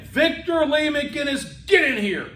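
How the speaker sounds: put-on high-pitched, drawn-out summons of a child; stern mom's high holler; tough mother calling. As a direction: imitate a mother yelling a full name through the house. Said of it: "when I heard her go, my full name".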